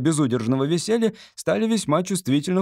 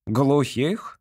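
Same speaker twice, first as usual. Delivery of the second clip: ordinary delivery, nothing marked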